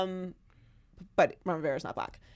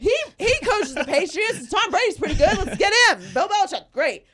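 much higher, louder mock hype; mock team executives; 11 semitones higher, 6 dB louder than usual. Mocking the Giants front office picking a coach, giddy high voice. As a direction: parody the Giants' brass getting giddy. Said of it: "and they were like".

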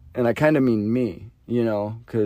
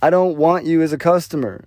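defiant retort; 5 semitones higher than usual. Retold or imitated. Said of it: "I guess my ego".

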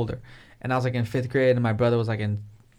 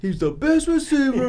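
much higher, slowed whiny protest; mock indignant friend; 11 semitones higher, 3 dB louder, slower than usual. Imitating upset friend defending his receiver, mock whine. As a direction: play the offended fan. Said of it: "And he got all mad".

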